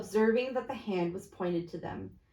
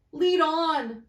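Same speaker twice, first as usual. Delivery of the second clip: raised, urgent command; urgent Scrooge; 8 semitones higher, 6 dB louder than usual. Acted. Scrooge urging the phantom forward, raised and urgent. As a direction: push the spirit onward. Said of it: "said Scrooge".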